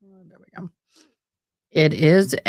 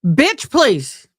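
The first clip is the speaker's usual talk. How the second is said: higher and louder, full sass; sister's sassy catchphrase; 6 semitones higher, 7 dB louder than usual. Retold or imitated. Imitating her sister's sassy put-down, higher and louder. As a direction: fling it out like the sister would. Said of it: "the infamous and famous words of my sister".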